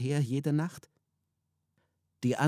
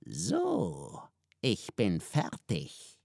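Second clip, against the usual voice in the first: lightly voiced devil; 2 semitones lower, much slower than usual. A narrator's reading. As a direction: drop it offhandedly, slow. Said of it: "und sagte".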